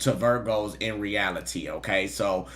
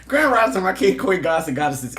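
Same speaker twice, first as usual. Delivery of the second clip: higher, louder show-opening greeting; hyped intro; 6 semitones higher, 5 dB louder than usual. Real, not acted. Streamer officially opening the live reading for viewers, hyped. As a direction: kick off the stream with big energy. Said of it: "Hold on, I gotta do the introduction".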